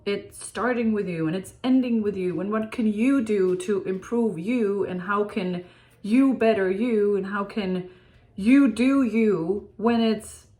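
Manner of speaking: normal talking voice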